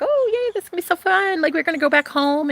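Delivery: bubbly imitation of the winners; excited. Katniss and Peeta celebrating, mock excited. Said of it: "They were like".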